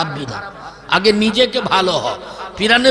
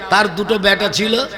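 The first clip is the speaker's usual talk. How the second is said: ordinary talk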